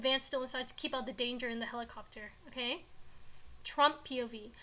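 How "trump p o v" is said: ordinary delivery, nothing marked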